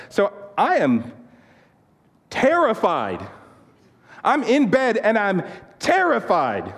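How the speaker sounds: high-pitched, hammed-up fright; retold terror. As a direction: act out being scared stiff, stretching the key words. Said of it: "That's how terrified I was".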